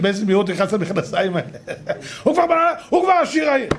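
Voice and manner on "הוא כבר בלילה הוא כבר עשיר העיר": high-pitched mock exclamation; mocking amazement. Speaker mocking the instant rich man, mock-amazed. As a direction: crow it high, poking fun.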